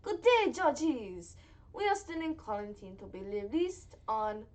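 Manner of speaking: a weak, slow attempt at a French accent; barely-there French accent reading a listener letter; failed French accent. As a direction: try a French accent and fall short. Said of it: "I'm trying to do a French accent".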